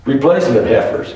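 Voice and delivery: ordinary talk, nothing marked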